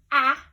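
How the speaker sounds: "ah" as a loud, clear short a; short a sound. Shown as a demonstration. Teacher loudly models "ah" for listening game. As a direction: punch out "ah" so kids hear the vowel. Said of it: "Do you hear".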